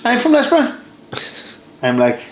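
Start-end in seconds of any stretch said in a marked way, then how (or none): 0.1-0.7 high-pitched voice of surprised recognition; imitated excited woman. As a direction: mimic her piping up about a shared hometown. Woman delighted by shared hometown.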